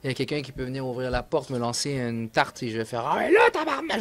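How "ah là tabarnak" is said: put-on accent québécois, juron lancé très haut; s'exclamer en Québécois surpris et fâché. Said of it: "Je vais commencer à parler en québécois". (un cri québécois plus aigu quand on reçoit une tarte)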